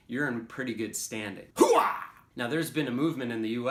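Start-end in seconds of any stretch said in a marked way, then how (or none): 1.6-2.0 loud, high army war cry; gung-ho soldier shout. Acted. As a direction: bark it like a pumped-up soldier. Exclaiming interjection examples.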